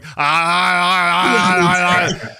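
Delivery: loud rhythmic moans; mock moaning toilet. Toilet moaning greedily when the lid opens, rhythmic moans. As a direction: moan like a hungry toilet. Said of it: "it's just going".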